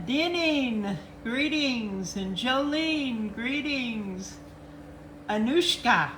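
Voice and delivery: ordinary talk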